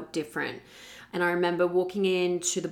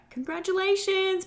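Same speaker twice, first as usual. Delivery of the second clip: much higher, chirpy congratulations; bright receptionist; 14 semitones higher than usual. Retold or imitated. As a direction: mimic cheerful staff. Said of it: "they're all kind of like".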